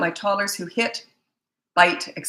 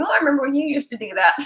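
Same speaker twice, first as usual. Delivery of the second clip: teasing reminder; 8 semitones higher, faster than usual. Retold or imitated. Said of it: "I'll be right in there saying".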